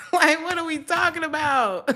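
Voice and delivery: high, loud laughing question; laughing disbelief. Real, not acted. Amazed at the reading, laughing loud and high.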